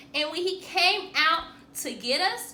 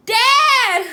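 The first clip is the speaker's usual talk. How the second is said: high, loud call for attention; 11 semitones higher, 13 dB louder than usual. A narrator's reading. Boy calling to his dad at dinner, loud and high. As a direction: grab Dad's attention.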